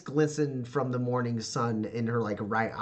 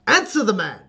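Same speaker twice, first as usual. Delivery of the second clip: loud, raised-pitch paternal order; sharp command; 9 semitones higher, 9 dB louder than usual. Acted. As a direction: demand an answer at once. Father orders daughter to answer.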